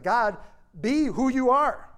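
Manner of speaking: high, loud, slow plea to God; crying out to God. Retold or imitated. Preacher voicing David crying out to God, a loud slow plea. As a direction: cry it out, begging God to show himself. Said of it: "David cries out".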